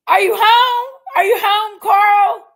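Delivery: loud, high-pitched holler; calling out. Real, not acted. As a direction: shout the question as if across the house. Calling out to Carl.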